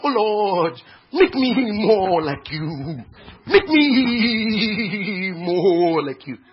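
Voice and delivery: loud, drawn-out pleading to God; mock pious prayer. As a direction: stretch the words like an overwrought worshipper. Mocking pious prayer of the church.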